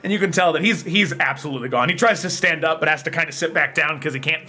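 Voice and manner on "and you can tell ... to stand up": normal talking voice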